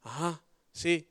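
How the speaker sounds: lightly voiced half-listening husband, slow low grunts; absent-minded mock husband's uh-huh; inattentive husband. Husband half listening while his wife talks on, absently. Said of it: "están hable y hable y hable y el marido".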